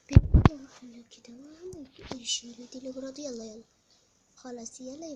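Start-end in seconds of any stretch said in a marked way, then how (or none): 0.1-0.5 loud burst at the daughter; father suddenly shouting. Pretend. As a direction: call it out loudly to her. Father talking to daughter in play.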